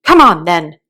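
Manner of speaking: loud roar. A narrator's reading. Queen roaring at Alice to join, loud. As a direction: roar the order. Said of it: "roared the queen".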